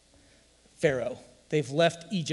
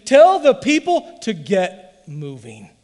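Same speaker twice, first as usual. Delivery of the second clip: louder, slow booming rebuke; the Lord commanding; 9 semitones higher, 11 dB louder, slower than usual. Retold or imitated. The Lord rebuking Moses, loud and slow. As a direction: thunder a command to get moving. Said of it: "the Lord said to Moses".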